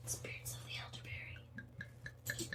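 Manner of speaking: very faint, slow, breath-only whisper; ghostly whisper. Pretend. Co-host echoing the elderberry spirits joke, barely audible ghostly whisper. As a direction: let it fade like a mock ghostly echo. Spooky echo fading away.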